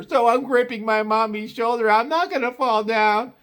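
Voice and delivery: put-on high little girl voice; childlike high voice. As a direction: voice the small sister clinging to mom.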